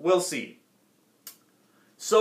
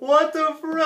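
higher, quicker what the freak; high disbelief; 9 semitones higher than usual. Real, not acted. Viewer repeating in disbelief, pitched higher. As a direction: fire it up high, incredulous.

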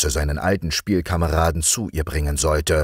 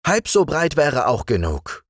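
put-on raised, higher young king; king appointing his friend the frog chamberlain, higher voice; young king's voice; 10 semitones higher than usual. A narrator's reading. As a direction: play the young king granting an honour. King's dialogue.

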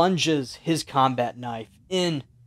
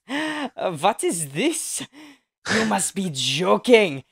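high, slow, mocking vampire voice; game master voicing the vampire, mocking and disdainful; vampire villain; 8 semitones higher, 4 dB louder, slower than usual. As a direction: scoff down at him like a bored undead lord. GM voices vampire Von Kruger.

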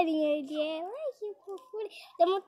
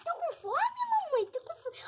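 higher, softer whiny puppy voice; voicing the puppy; 10 semitones higher, 6 dB quieter than usual. Owner voices the hungry puppy calling mamãe, whiny and higher. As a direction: whine it like a hungry little pup.

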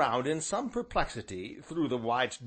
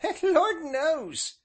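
put-on high, slow old countryman; slow old rustic shrug; 6 dB louder, much slower than usual. A narrator's reading. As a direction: drawl it out with a resigned shrug. Ancient's dialect voice.

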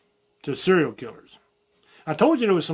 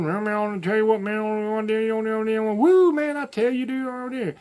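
much higher, slurred Southern Tuckio mumble; King of the Hill mumbler; 5 semitones higher than usual. Retold or imitated. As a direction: run the words together in a Texas mumble like the King of the Hill guy. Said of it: "that one guy that stands on the corner".

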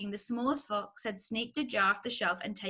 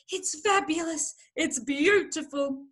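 higher, slowing exclamations; breathless rapture; 6 semitones higher, 3 dB louder than usual. A narrator's reading. Fox overwhelmed by a gulp of cider, breathless. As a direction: gasp out praise with growing rapture. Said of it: "he whispered, fighting for breath".

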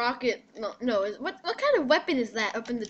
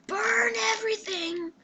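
high, drawn-out pyro cry; pyro battle cry; 9 semitones higher than usual. Pretend. Player voices his pyro for viewers, high put-on cry. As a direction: stretch it out high, slowly, in a put-on voice.

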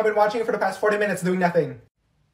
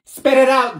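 ordinary talk, nothing marked

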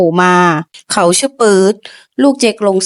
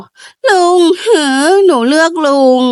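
slow, choked-up tearful words; 6 semitones higher, slower than usual. A narrator's reading. Girl choosing her uncle in front of the crowd, tearful. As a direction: barely hold back the tears. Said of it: "ไหมน้ำตาคอ".

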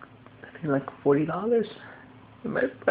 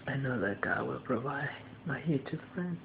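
quietly trusting, lower and softer; soft, lower reassurance; 7 semitones lower, 8 dB quieter than usual. Real, not acted. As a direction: tell yourself calmly that God will provide. Faith amid money worries. (soft, lower prayerful reassurance)

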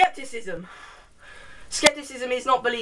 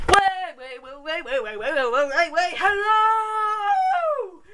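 babbled repeats swelling into a long high hello; chanted waits building to a high-pitched greeting; silly vlog opening; 4 semitones higher, slower than usual. Pretend. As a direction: play a goofy intro, ending high and drawn-out.

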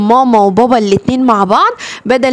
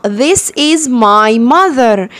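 very slow, carefully stretched English; نطق بطيء جدًا; much slower than usual. Shown as a demonstration. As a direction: stretch every word for beginners. Teacher models English sentence. (قراءة إنجليزي بطيئة جدًا)

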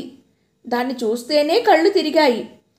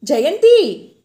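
calling out; 3 semitones higher, 6 dB louder, much slower than usual. A narrator's reading. Husband calling her awake.

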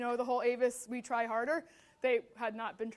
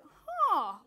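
raised, drawn-out huh of realization; imitated realization; 5 semitones higher, 4 dB louder than usual. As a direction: let out a huh as it dawns on you. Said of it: "Everyone in the class kinda did this".

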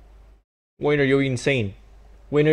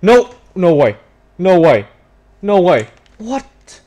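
loud, high protest of no way; outraged disbelief; 9 semitones higher, 7 dB louder, slower than usual. Real, not acted. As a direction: protest the call like you were robbed. Goal ruled out in match.

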